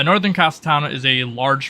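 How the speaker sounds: ordinary delivery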